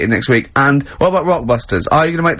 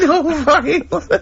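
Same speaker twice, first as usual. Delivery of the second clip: giggling through a mock repeat of his phrase; laughing mockery; 11 semitones higher, faster than usual. Real, not acted. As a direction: laugh while parroting the odd phrase. Mocking the phrase while laughing.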